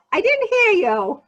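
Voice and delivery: ordinary talk, nothing marked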